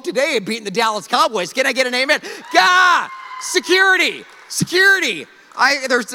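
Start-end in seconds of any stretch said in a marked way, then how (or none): 2.5-5.3 high, loud joking cry; hammed-up shout for security; mock alarm shout. Real, not acted. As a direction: call out for security in jest.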